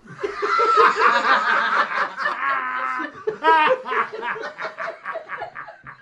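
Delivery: long high burst of laughter. Real, not acted. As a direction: laugh out loud at your own joke. Laughs at own joke.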